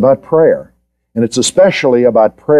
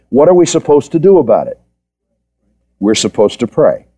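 ordinary delivery

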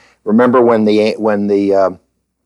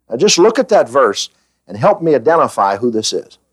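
ordinary talk, nothing marked

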